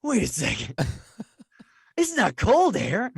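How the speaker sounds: acted dawning discovery, slowing and rising; mock eureka, drawn out at the end; boy's sudden realisation. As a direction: work it out aloud as the boy. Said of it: "he's like".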